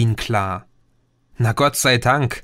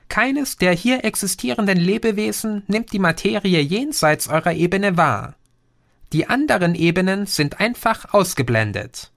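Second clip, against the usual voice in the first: lightly voiced alien, somewhat higher; a patient know-it-all explaining; Green's voice; 7 semitones higher than usual. (leicht höher gesprochener Außerirdischer, belehrend)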